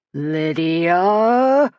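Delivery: a long, rising bellow. A narrator's reading. Angry leader summons the girl, bellowing. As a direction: roar her name across the yard. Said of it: "bellowed Klaus Elam".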